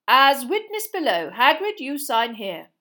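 loud, formal proclamation of a sentence; pompous official reading a decree aloud; official reading verdict. A narrator's reading. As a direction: read out the death sentence like a stiff minister. Official orders Hagrid to sign.

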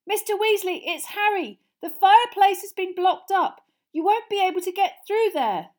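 high, loud, urgent explanation; calling through boards. Acted. Harry warning Mister Weasley fireplace is blocked, calling. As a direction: raise your voice to carry through.

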